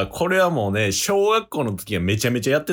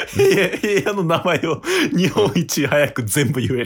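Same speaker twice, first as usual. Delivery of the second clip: laughing; 6 semitones higher, slower than usual. Real, not acted. Explaining his odd talent.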